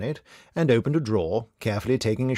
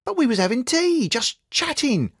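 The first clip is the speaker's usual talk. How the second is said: Tim's voice; 14 semitones higher, 3 dB louder than usual. A narrator's reading. Tim protests, voiced higher.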